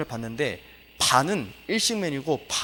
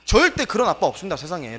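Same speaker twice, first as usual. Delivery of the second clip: preaching at full force; 3 semitones higher, 6 dB louder than usual. Real, not acted. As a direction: hammer each phrase home to the congregation. Preacher raises voice to defend Lot.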